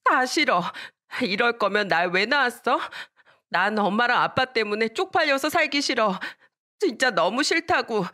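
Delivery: put-on high-pitched child's bitter complaint; resentful outburst. Daughter blames her mother at home, high resentful outburst. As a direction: lash out like a hurt child. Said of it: "엄마를 원망하며 말했어요".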